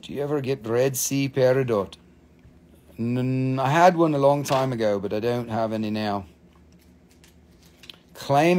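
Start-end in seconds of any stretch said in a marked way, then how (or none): none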